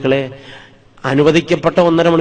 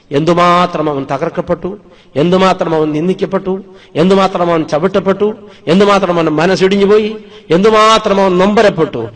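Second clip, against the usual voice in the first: each line higher and louder, from plain to full force; preacher building to a crescendo for the congregation; building preaching; 4 semitones higher, 4 dB louder than usual. Acted. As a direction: build the litany to a peak. Preacher's rising litany.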